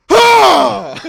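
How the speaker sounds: loud high yelp; dad's yelp. Retold or imitated. Son imitating his dad's pain yell, loud high yelp. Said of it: "you just hear a".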